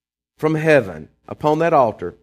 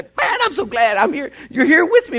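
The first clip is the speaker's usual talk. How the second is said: God's voice, higher, laughing with delight; voicing God, joyful; 10 semitones higher than usual. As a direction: act out a glad God welcoming his people, laughing. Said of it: "wanting to just come out there and just say".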